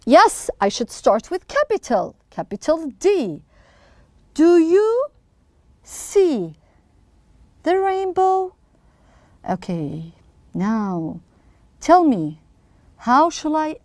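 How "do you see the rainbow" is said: broken into slow pieces with long gaps; slow, deliberate classroom dictation; word-by-word dictation. Shown as a demonstration. Teacher says words while writing them.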